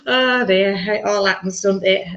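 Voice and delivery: ordinary talk, nothing marked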